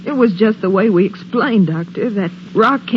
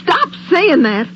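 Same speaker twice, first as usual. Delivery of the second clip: sharp, slower plea to stop; frightened snap; 7 semitones higher, slower than usual. Acted. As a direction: cut her off before she scares you more. Frightened friend begs her to stop.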